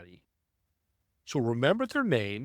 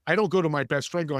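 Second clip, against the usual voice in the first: normal talking voice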